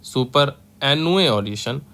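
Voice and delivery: stretched out, syllable by syllable; each syllable held long; slow syllable by syllable. Shown as a demonstration. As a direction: draw the word out slowly for the class. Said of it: "क्या हो गया?".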